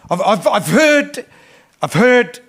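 slow insistent preacher's cry; emphatic repetition. Acted. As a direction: drive home that God has heard. Preacher repeats God's answer with force.

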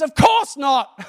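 loud, drawn-out "of course not"; fed-up fishermen yelling back; exasperated shout. Retold or imitated. Fishermen's blunt answer shouted.

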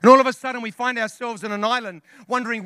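ordinary talk

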